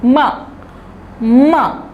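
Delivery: "माँ" with a nasal vowel and no n; open-mouthed "माँ", no tongue contact; nasal māñ. Shown as a demonstration. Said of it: "मेरी ज़बान जो है वो तलवों पे ऊपर नहीं जा रही है".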